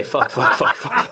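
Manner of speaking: jokingly imitating a friend blurting curses through laughter; laughing mock tic outburst. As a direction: tease them with giggling fake tics. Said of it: "'Cause you say".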